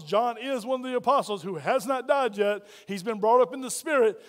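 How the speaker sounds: ordinary talk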